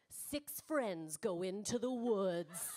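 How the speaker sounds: slow, low movie-trailer read; lightly voiced trailer narrator. Shown as a demonstration. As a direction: read it like an ominous horror trailer. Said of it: "start off like this".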